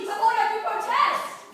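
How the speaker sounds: high, loud desperate plea; loud protest. Acted. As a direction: cry out against the charge. Pleading against accusation.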